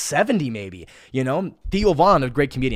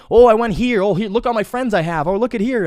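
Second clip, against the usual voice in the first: higher, louder bragging voice for a highlight reel; mocking show-off; 7 semitones higher, 6 dB louder than usual. Mocking people's highlight reels, higher and louder bragging. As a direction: sneer out a show-off's brag. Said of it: "highlight reels of their fucking day".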